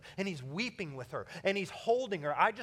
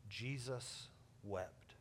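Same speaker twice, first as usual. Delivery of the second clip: hushed; 6 semitones lower, 11 dB quieter, much slower than usual. Acted. Solemn pause in sermon.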